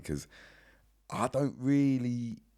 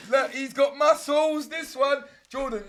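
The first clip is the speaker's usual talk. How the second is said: excited; 13 semitones higher, 5 dB louder, slower than usual. Real, not acted. Hyping up the muscular guest.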